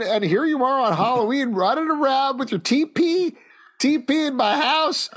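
put-on high, indignant old man rant; shouting at trespasser. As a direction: scold the kids from the porch. Cranky homeowner rant.

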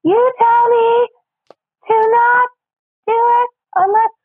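put-on very high, slow comic child voice; high little-boy character, dropping on the last word; Stuart. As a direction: drag it out in a squeaky kid voice. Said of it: "I've got a Stuart impression".

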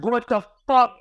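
high, startled what the fuck; shocked cry. Retold or imitated. To his friend, seeing the gargoyle vanish, a high shocked cry. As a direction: yelp it out in disbelief.

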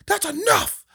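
high, loud sharp call; ref yelling. Retold or imitated. Referee shouting at the parent, high loud mock yell. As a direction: snap it at the crowd like a ref. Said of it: "Ref's like".

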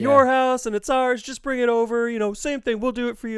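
lightly acted neighbour, pitched high; high-pitched, chatty quote; friendly neighbour. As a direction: imitate a neighbour cheerfully offering a favour. Voicing friendly neighbours offering help.

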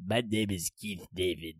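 low, slow, soft put-on voice; slow low-pitched impersonation, quietly done; Keith David. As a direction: attempt a deep, measured actor's voice. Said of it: "I can't do Keith David impersonation".

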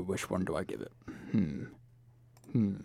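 low, soft musing with drawn-in hmms; beard-stroking deliberation, played up softly; mock pondering. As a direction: act out deliberating, quietly to yourself. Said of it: "I stroke my beard".

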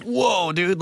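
high-pitched mock astonishment; startled reaction. Onlooker reacting to cracking knuckles on a broken hand, startled. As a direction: react with a drawn-up, startled whoa.

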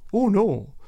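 ordinary talk

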